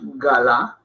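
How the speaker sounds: "galá" in two full syllables, stressed at the end; mistaken full vowels. Shown as a demonstration. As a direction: give both A's in "galá" full value. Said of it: "Y no es".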